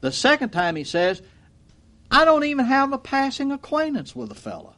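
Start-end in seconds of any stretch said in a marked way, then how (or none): 2.1-4.7 much higher-pitched mock protest; high-pitched, put-on insistent denial; acted denial. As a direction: play Peter protesting, pitched well up. Peter acted denying Jesus.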